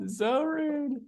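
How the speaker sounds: high, soft drawn-out whine; high little mock-offended voice; mock hurt. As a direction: draw it out softly, high and slow. Repeats it as mock complaint, squeaky.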